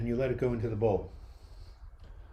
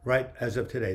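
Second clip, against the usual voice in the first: ordinary talk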